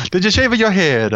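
high, hammed-up Italian accent; bad Italian accent. Shown as a demonstration. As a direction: ask it in a jokey fake Italian accent. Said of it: "plus two points if you put it in a bad Italian accent".

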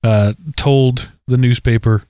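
usual speaking voice

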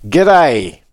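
loud, lifted Australian g'day; Australian accent. Acted. Co-host answering the howdy with his greeting, Australian accent. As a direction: belt out a hearty Australian greeting. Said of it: "so I'll just say".

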